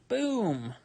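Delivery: high punchy reveal; excited. Real, not acted. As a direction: pop it out proudly. Showing off gradient tool.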